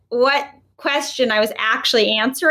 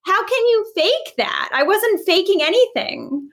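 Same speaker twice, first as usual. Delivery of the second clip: higher, slower, mildly indignant retort; hurt disbelief; 7 semitones higher than usual. Retold or imitated. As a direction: push back on the accusation, stunned. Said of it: "And I was like".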